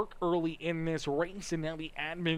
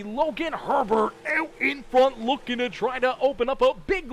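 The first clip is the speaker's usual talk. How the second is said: raised, punchy race call; excited play-by-play; 6 semitones higher, 5 dB louder than usual. Real, not acted. Track announcer calling the green flag restart, excited play-by-play. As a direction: call the restart high and loud.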